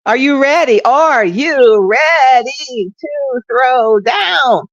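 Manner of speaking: high, loud cheerleader call, slow and drawn out; rally the crowd like a stiff cheerleader; stiff cheer. Pretend. Radio host mock-cheering her listeners, high and loud. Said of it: "Like I'm doing stick cheers".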